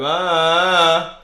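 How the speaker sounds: sharp loud high ah; loud shout. Shown as a demonstration. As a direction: shout ah to make it peak. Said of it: "Unless we go".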